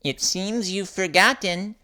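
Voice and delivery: high chirpy bird voice. Acted. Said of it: "chirped Avery the bird".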